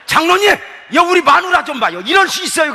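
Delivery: high, loud hammed-up complaint; mock tattling. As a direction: whine to the elder like a tattling child. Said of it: "내가 우리 장로님한테 일렀지 뭐야".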